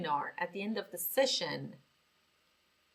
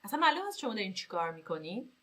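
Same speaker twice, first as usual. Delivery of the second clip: ordinary delivery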